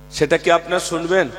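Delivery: usual speaking voice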